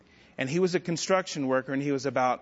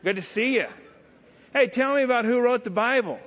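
Lane Flynn; 4 semitones higher than usual. Retold or imitated. Construction worker teasing the young believer, mocking heckler voice. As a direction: needle him in a higher, big-mouth voice. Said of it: "this is the way he talked. This is his voice".

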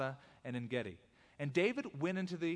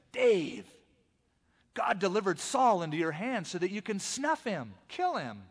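eager, pressing whisper-free push to kill; a man urging his leader to strike; 5 semitones higher, 5 dB louder than usual. Acted. David's man urging him to kill Saul, eager.

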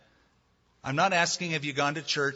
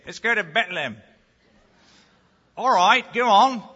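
put-on light British accent, a bit louder; 6 semitones higher, 10 dB louder than usual. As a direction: say it like a Brit. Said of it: "you can hear a Brit saying it".

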